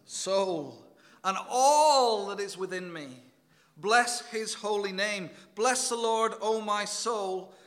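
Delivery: loud, slow, ringing recital of the psalm; proclaiming scripture. Acted. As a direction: declaim each line like a call to worship. Preacher recites Psalm 103.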